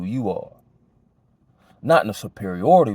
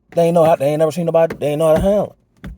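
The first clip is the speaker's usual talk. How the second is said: excited; 7 semitones higher, 6 dB louder, faster than usual. Real, not acted. Excited retelling of their reaction.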